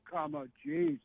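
usual speaking voice

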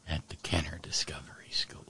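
sly whispered aside. Real, not acted. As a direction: whisper it knowingly. Pointed sarcastic aside.

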